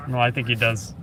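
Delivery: ordinary talk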